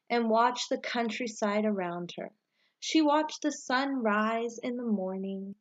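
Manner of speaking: put-on reading a picture book aloud to children, lively and rising at each new season; bright, expressive read-aloud storyteller voice; story narration. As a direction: tell it like story time, lifting the start of each season. Teacher reads storybook aloud.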